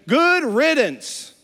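"Good riddance." drawn out high and loud; dismissive. Retold or imitated. Older brother dismisses his runaway sibling, mock sneer.

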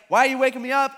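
loud, high protest at being woken; irritated at being woken. Retold or imitated. Woken Jesus to his disciples, irritated. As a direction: complain loudly at whoever woke you.

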